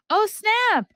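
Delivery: shocked the book caught you; excited shock. Real, not acted. Finding her nose habit listed with loud surprise. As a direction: gasp then a very high, loud oh snap. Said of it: "page reveals bad sign".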